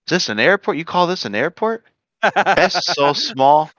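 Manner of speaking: high, scoffing visitor's disbelief; incredulous Texan. Retold or imitated. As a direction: play an unimpressed Texan cousin. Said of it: "the guy goes".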